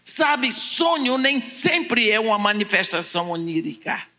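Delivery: usual speaking voice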